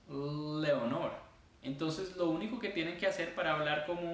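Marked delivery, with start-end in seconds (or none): 0.1-1.1 "Leonor" with a drawn-out humming L; gringo-style "Leonor", L pressed and lengthened; long English L. Shown as a demonstration. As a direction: hold the first L of "Leonor" before the vowel. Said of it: "en inglés sería".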